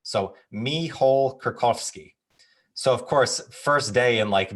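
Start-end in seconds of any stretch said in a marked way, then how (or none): 0.0-2.1 Michal Kurkowski said slowly the Polish way, Michal as MEE-hal; mock-formal slow reading of Michal Kurkowski in the librarian's Polish way; Polish name pronunciation. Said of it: "So your first name is Michal".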